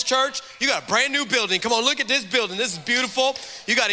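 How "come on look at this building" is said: usual speaking voice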